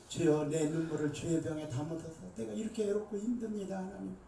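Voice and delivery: retold prayer in a hushed voice. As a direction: murmur the plea to God. Retold tearful prayer, quiet.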